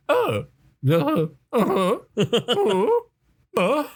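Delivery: high, far-off mock agreeing voice; high hammed-up uh-huhs; imitating the Prime Minister. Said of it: "the person who's the Prime Minister of the U K the whole time is just going".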